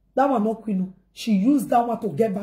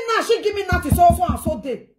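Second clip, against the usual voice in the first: mimicking her boast in a raised voice; mocking quote; 8 semitones higher than usual. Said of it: "He said".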